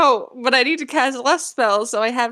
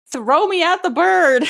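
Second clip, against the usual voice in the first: drawn-out shout, higher and louder; in-character shout; 7 semitones higher, 5 dB louder, slower than usual. Acted. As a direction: call it out slowly to a comrade. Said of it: "Malin's gonna shout to Evie".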